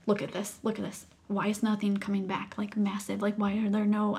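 soft, near-whispered questioning; hushed disbelief. Retold or imitated. Mother shows the nurse the skin test, hushed disbelief. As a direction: drop right down, stunned.

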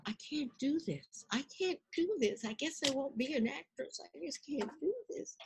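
a quiet anxious self-talk; despairing inner voice. Retold or imitated. Girl thinking she can't learn the speech, soft. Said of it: "and I thought".

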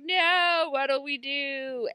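high, drawn-out mock panic; mock dismay. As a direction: wail it as a hammed-up helpless plea. Mimics trapped characters' dismay.

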